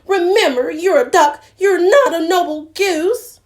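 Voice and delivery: high, loud scolding goose; fussy goose. Acted. Goose reminding Doddle he's a duck, loud. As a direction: keep honking the scolding. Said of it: "she honked".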